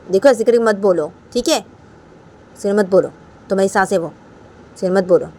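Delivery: firm, loud telling-off; acted scolding. Raima's abbu telling Bani off, loud acted scolding. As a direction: scold in short, loud phrases.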